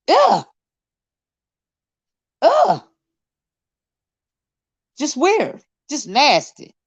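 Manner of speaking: high disgusted groan. Real, not acted. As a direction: say it with real disgust. Disgust at touching.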